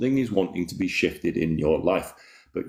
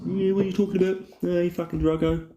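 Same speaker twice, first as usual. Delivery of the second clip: mock Aussie bloke; 11 semitones higher than usual. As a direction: greet your mate like a cartoon bloke. Teasing viewer in put-on voice.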